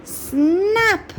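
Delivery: high sharp snap; snapping sound. Acted. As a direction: crack it out like a breaking tusk. Tusk breaks in the story.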